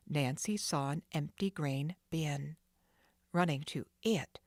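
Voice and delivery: usual speaking voice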